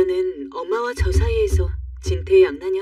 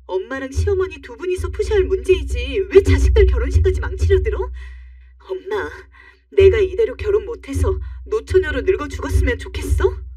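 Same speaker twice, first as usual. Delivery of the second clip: acted outburst at mom, higher and louder in bursts; angry yelling; 4 semitones higher, 3 dB louder than usual. Daughter confronts her mother over the wedding, angry yelling. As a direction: rail at her in rising, indignant protest. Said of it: "너무 화가 나 버럭버럭 소리를 지르고 말았어요".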